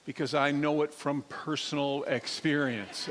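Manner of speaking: normal talking voice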